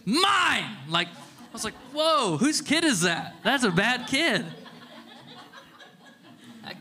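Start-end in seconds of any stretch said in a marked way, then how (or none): 0.1-0.8 put-on high, loud possessive toddler yell; mock toddler shout. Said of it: "pushes this little girl off and says". 1.9-4.5 higher, louder, slowed astonished exclaim ending in a laugh; mock shocked outburst. Dad shocked at toddler pushing.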